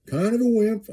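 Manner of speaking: high self-mocking echo of "Kind of a wimp"; mock whiny repeat. Real, not acted. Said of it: "I'm kind of a wimp".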